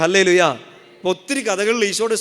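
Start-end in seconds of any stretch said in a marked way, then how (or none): 0.0-0.4 loud, powerful praise shout; forceful hallelujah. Shown as a demonstration. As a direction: call it out with strength. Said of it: "ശക്തിയോട് പറഞ്ഞേ".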